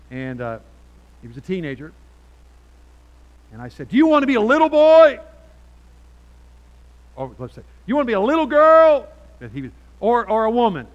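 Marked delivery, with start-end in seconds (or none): 3.9-5.2 high, booming challenge; shouted challenge. Retold or imitated. Loud challenge to a teenager. 7.9-9.1 high, booming challenge; shouted challenge. Retold or imitated. Same challenge, girl version. 10.0-10.9 loud, raised alternative; loud challenge. Retold or imitated. Second half of loud challenge.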